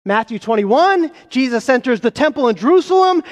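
ordinary talk

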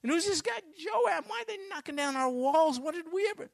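high, loud, bewildered questions; a scared citizen who doesn't understand the siege; panicked townsman. Retold or imitated. Besieged townsman asks his neighbours, high and alarmed.

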